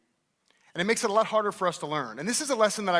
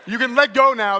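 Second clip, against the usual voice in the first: much louder, higher call over the room; 5 semitones higher, 10 dB louder than usual. Real, not acted. Speaker to gripping audience, loud call out. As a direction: shout to make them let go. Players still gripping fingers.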